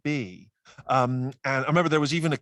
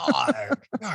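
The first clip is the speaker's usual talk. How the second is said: hammed-up pirate "yar", pitched up; pirate growl; 9 semitones higher than usual. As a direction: throw out mock pirate cries. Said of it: "I can only hear a pirate".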